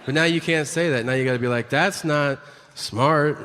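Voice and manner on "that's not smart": overly careful. Retold or imitated. Forced polite substitute phrase.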